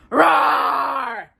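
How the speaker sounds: loud mock roar; tiger roar. Raja lunges at Jafar with a mock roar. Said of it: "Raja let out a roar".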